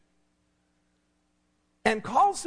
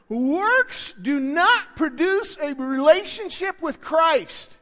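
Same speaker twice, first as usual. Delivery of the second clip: works do not produce, said slowly and weighted; slow emphasis; 3 semitones higher, slower than usual. Shown as a demonstration. Preacher slowing down so the church can write it. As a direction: lay each word down slowly so they write it. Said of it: "write this down".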